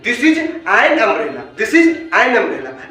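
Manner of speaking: very slow, each English word spaced out; slow dictation. Shown as a demonstration. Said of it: "जैसे कि". (बहुत धीरे, एक-एक शब्द अलग करके)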